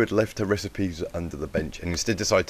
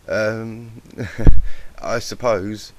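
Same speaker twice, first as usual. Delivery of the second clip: awkward little laugh, louder, higher; nervous laugh; 4 semitones higher, 15 dB louder, much slower than usual. Acted. Colt laughs nervously at teacher.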